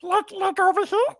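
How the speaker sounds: very high little squeak; squeaky Drusilla voice. Pretend. Voice actor answering what Drusilla sounds like, squeaky voice. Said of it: "Drusilla's kind of squeaky".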